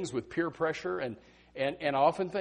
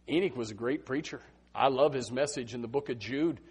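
ordinary delivery

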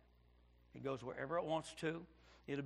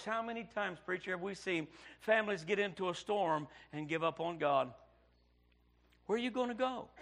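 preaching; 3 semitones higher than usual. Acted. Preacher asking the congregation, pulpit appeal. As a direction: plead with the congregation.

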